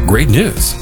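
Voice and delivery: normal talking voice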